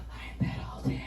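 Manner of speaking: soft whispered daydream; dreamy whisper. Retold or imitated. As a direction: whisper it longingly.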